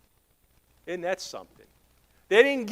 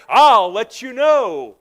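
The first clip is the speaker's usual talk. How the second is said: slow, drawn-out loud reply; the Lord answering; 2 semitones higher, 6 dB louder, slower than usual. Retold or imitated. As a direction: stretch each word like an unhurried divine answer. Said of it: "The Lord said".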